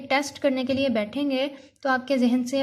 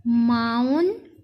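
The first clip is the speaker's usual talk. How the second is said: Mountain as drawn-out "माउन", long pause, "टेन"; split into syllables; 3 dB louder, slower than usual. Shown as a demonstration. Teacher reading for pupils, mountain split in two. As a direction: break mountain into two slow parts. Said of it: "इसके अलग-अलग".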